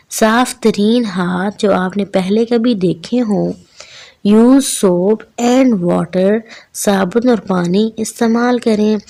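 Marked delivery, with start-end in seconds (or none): 4.3-6.4 slow, deliberate poem recitation; poem read aloud. Shown as a demonstration. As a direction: recite it slowly, word by word, for the children. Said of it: "ऊंची आवाज़ में पढ़ना". (कविता ऊंची आवाज़ में, धीरे-धीरे पढ़ते हुए)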